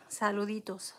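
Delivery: soft unvoiced whisper; whispered greeting. Real, not acted. Streamer greeting Jesús in chat, whispering. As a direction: breathe the greeting gently.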